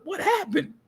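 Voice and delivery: high-pitched baffled question; mock bewilderment. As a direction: squeak it out, totally clueless. Retold bewildered reaction, shrugging.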